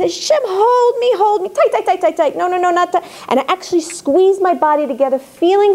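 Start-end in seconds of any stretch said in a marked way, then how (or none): 0.0-3.0 high, urgent, repetitive plea; pleading to be held. Retold or imitated. Woman begging Hashem for a tight embrace, insistent pleading. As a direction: plead upward, hammering the repeated words. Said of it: "That's how I talk to Hashem".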